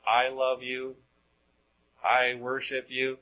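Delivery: monotone robot voice; programmed robot. Acted. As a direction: recite it evenly, without any feeling. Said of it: "a whole bunch of robots".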